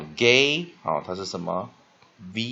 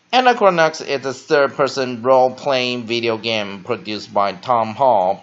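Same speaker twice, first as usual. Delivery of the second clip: loud, measured read-through of an English article; teacher reading aloud; 2 semitones higher, 12 dB louder, slower than usual. Shown as a demonstration. Teacher reading an article to students, loud and measured. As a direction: read it out loudly in one steady flow. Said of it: "那我们老师会把它念在".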